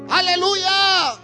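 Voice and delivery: jubilant shout. Real, not acted. Preacher crying out to the congregation, jubilant drawn-out shout. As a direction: let the Aleluya ring out to the back wall.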